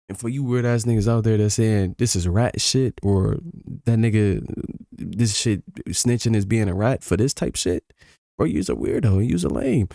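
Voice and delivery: loud, heated calling-out; angry rant. Real, not acted. Streamer ranting loudly at viewers who call it snitching. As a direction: blast the haters in chat.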